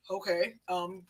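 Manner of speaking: a hint of an uncle addressing the camp; uncle's formal speech.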